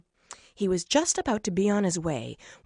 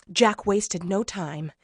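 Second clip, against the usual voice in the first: ordinary delivery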